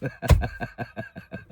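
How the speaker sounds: voiceless wheezy laughter; real breathy laugh; amused laugh. Real, not acted. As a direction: burst out laughing at your own line. Laughs at own childhood remark.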